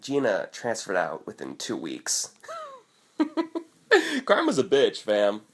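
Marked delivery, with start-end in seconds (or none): 3.2-5.4 laughing, high gloating punchline. Real, not acted. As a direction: laugh it out, smug.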